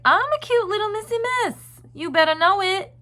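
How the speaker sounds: put-on high, loud sassy little girl voice. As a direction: play a proud little girl who knows who she is. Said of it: "embody that sass".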